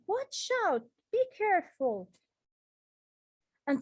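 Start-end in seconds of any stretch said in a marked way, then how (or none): 0.1-2.1 much higher, sharp urgent call; urgent warning. Shown as a demonstration. Teacher acting out a warning for students, urgent high call. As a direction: warn someone of danger. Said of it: "shows surprise or strong feeling".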